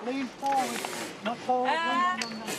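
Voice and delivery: high urgent instructions; urgent calling out. Real, not acted. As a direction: call out in alarm. Coaching wife onto Segway.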